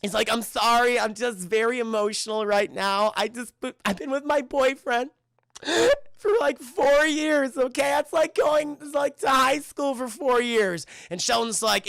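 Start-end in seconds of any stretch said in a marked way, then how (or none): 0.3-10.9 high, whiny crying voice, words stretched; imitation of weepy oversharing; mock tearful breakdown. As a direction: blubber through it, hammed up. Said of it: "she starts breaking down in tears".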